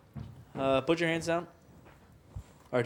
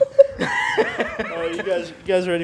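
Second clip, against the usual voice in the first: laughing; 4 semitones higher than usual. Real, not acted. Host laughing during game.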